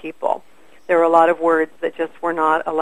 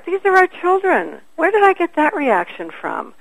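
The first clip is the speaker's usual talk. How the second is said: self-correcting inner voice; 11 semitones higher than usual. Retold or imitated. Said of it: "And I go".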